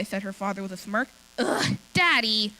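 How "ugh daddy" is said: exasperated. A narrator's reading. Girl exasperated by teasing father.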